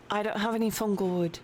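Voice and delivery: ordinary talk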